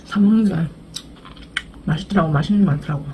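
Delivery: chewing between words; talking around food; mouth full of food. Real, not acted. Seen 2: Holds food at mouth; cheeks bulge and jaw works while speaking.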